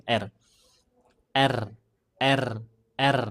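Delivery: R bergetar, ditrill panjang; trilled R. Shown as a demonstration. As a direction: getarkan lidah untuk R. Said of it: "kan namanya takrir". (huruf R digetarkan)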